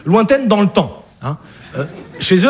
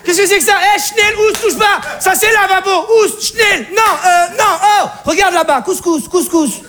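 panicked mother; 10 semitones higher than usual. Acted. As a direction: shriek at the intruder in alarm.

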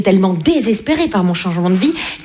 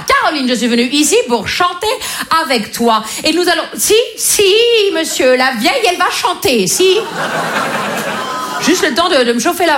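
mother's voice; 6 semitones higher than usual. Retold or imitated. Mother announces singing.

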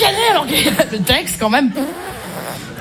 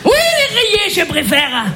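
put-on German babysitter accent. Babysitter ordering children to shower and pyjamas, heavy German accent. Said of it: "Humour germanique".

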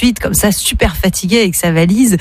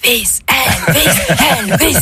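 slow, drawn-out fan chant, raised pitch; mock crowd yell; 4 semitones higher than usual. Crowd greeting the pope as [name], mock yelling. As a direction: imitate a yelling crowd without full volume. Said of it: "la foule qui gueule".

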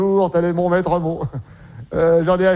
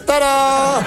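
tada reveal; 5 semitones higher than usual. Acted. Reveal from the fridge.

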